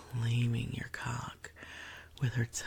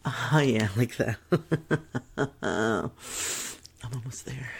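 ordinary delivery

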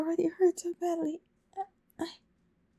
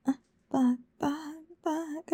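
ordinary talk, nothing marked

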